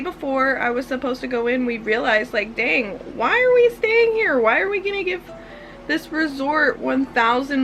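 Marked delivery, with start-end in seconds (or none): 2.5-5.2 sudden high "dang"; realizing aloud. Retold or imitated. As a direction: pitch jumps up on the realization. Retold realization quote.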